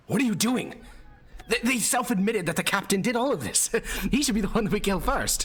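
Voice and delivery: a knight trying to whisper but nearly shouting; failed loud whisper. Acted. Knight leaning down to the Baroness, a loud failed whisper. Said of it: "He's trying to whisper, but he's talking loudly enough".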